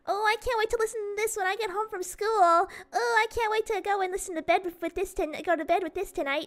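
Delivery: light imitation of eager kids; little kid voice. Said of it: "little kids ... talking".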